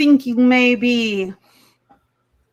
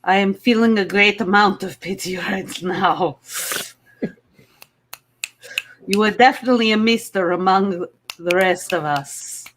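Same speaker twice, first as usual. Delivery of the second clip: put-on heavy Russian accent; 4 semitones lower, faster than usual. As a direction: play it as a Russian contestant. Miss Russia.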